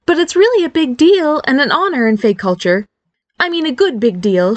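eager emphasis; emphatic. A narrator's reading. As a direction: lean on the key words to convince. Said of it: "she emphasized".

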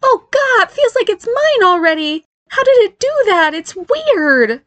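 very high-pitched freaked-out exclaiming; alarmed. Acted. Barry feels the new name stick, alarmed.

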